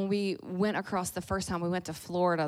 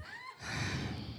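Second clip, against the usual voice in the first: very high breathless sigh of joy; airy delighted sigh; blissful sigh; 10 dB quieter than usual. Retold or imitated. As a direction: sigh like a kid in heaven. Daughter's happy sigh.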